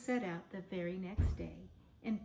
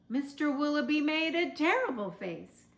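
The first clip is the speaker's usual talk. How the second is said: ordinary delivery, nothing marked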